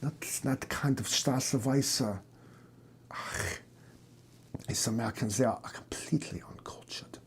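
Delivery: soft, near-whispered German scoff; muttered German accent. Acted. German doctor sneering at Americans, in a German accent. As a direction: grumble it under your breath in a German accent. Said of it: "Your stupid accent".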